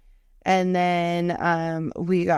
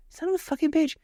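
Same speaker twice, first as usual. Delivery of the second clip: frustrated curse; 10 semitones higher than usual. Retold or imitated. Frustrated outburst at Alexa.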